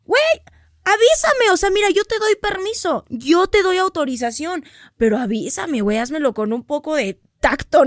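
shocked outburst at being shaved without warning; indignant yell. Retold or imitated. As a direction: complain loudly, outraged. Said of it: "y yo".

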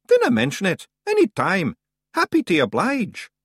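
high, loud broad Scottish pixie voice; a cocky wee Scots fighter; tiny Scots warrior. Acted. Rob Anybody answering the boy, high broad Scots.

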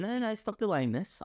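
high, impatient push to hurry up; impatient urging. Real, not acted. Pushing friend to eat chili.

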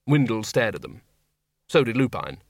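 usual speaking voice

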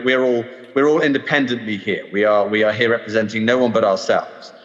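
ordinary delivery

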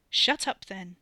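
tetchy and fed up; irritable. A narrator's reading. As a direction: snap it crossly, then let it fall. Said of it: "he sounded irritable".

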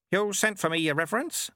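put-on dry, knowing old monk; wry elderly sweeper monk; Lutze's voice. Lutze answers abbot.

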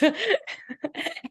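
high burst of laughter; real giggle breaking in. Real, not acted. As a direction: laugh out mid-story. Laugh telling the story.

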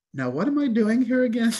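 high-pitched, puzzled mock self-talk; self-questioning. Weary missionary asking himself in the village, mock bewilderment. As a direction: imitate someone wondering aloud, pitched right up. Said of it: "You're gonna ask yourself".

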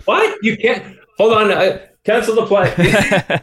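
high-pitched mock disbelief, laughing by the end; mock shock. Host jokes at a guest's remark about shifts, in mock shock. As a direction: play up a shocked objection as a joke.

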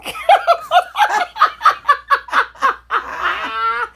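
high shrieking burst of laughter; high loud laugh. Real, not acted. Laughing at her own [name] line, high shrieking laugh. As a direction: cackle out loud.

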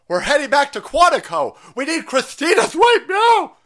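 high, loud hammed-up announcement; mock urgent call. Host playing fed summoning agents to Quantico, urgent. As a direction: call out to stragglers in mock alarm. Acts organiser calling to group.

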